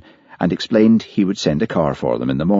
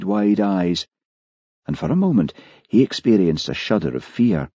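ordinary delivery, nothing marked

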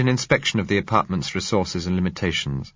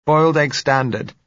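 put-on raised, lighter young woman's voice; 9 semitones higher, 6 dB louder than usual. A narrator's reading. Young woman claiming the bedroom, light and high. Josella answering from her room.

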